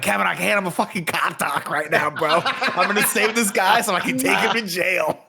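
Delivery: high, loud and laughing; mock heroic shout, laughing; excited quote. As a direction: play the hyped cop doc. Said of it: "I'm like".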